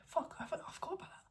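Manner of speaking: hushed sudden realisation; surprised whisper. Real, not acted. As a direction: whisper the oops.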